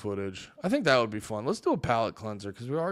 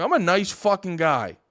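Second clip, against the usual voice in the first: ordinary talk, nothing marked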